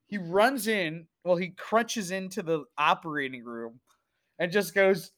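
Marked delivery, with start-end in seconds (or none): none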